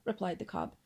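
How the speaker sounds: plain reading voice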